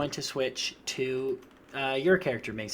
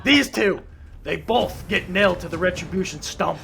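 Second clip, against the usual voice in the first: loud, raised proclamation; orc command; 3 semitones higher, 5 dB louder than usual. Acted. Hobgoblin sentencing both to the stump, loud command. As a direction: announce the punishment to the gang. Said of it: "and he goes".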